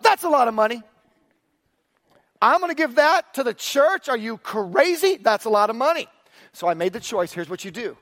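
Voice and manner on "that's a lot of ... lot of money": louder and higher, shocked at the sum; alarmed inner voice. Retold or imitated. Young engineer balking at his first tithe, alarmed. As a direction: gasp at the size of the check.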